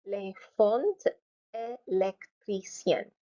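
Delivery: "Éléphant" and "électricien" slowly split up; syllable by syllable. Shown as a demonstration. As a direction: space out each syllable of "Éléphant", then "électricien". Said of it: "Four syllables".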